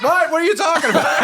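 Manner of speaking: high-pitched, shouted imitation; loud mock disbelief. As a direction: mimic friends peaking the microphone. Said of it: "we might peak on the volume".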